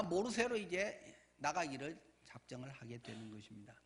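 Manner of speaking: ordinary delivery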